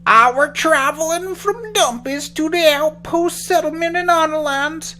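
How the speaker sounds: put-on high, loud, slow old man. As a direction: play a frail old man. Old man in tree tells.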